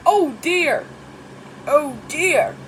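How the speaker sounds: loud alarmed oh dear; startled gasp. A narrator's reading. Grandpa startled by thunder, loud alarmed gasp. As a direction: jump at the sudden bang. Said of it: "gasped Grandpa Pig".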